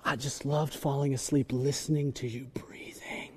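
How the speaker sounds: hushed, dreamy whisper; lovesick whisper. Retold or imitated. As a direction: whisper it softly like a sleepy lover. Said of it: "We would say things like".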